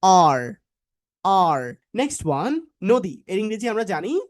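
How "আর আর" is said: "আর" low, from inside the throat; throaty R. Shown as a demonstration. Teacher shows where the sound comes from, throaty R. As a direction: push a strong "আর" out from the throat. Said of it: "গলার ভেতর থেকে সাউন্ডটা এইভাবে বের হবে".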